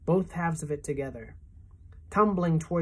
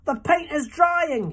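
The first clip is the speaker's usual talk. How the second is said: much higher, louder, slower moan; 11 semitones higher, 6 dB louder, slower than usual. A narrator's reading. Centipede moans as the paint dries, high and slow. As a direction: moan in self-pity. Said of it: "he moaned".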